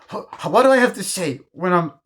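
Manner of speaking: high, loud, stammering protest; indignant character impression. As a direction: mimic a wounded, self-important man demanding respect. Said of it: "It's just the way he says it".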